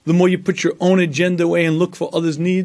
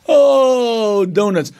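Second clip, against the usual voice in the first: mock group moan; 6 semitones higher, 3 dB louder, much slower than usual. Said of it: "the other women around the circle go".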